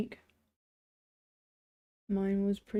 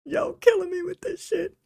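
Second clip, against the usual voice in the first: high, loud whine from her aching body; whiny complaining voice; 12 semitones higher, 5 dB louder than usual. Retold or imitated. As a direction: squeal it like a protesting body. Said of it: "My body is like".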